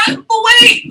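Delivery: high, loud mock shock; mock surprise. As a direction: play the outrun kid crying out.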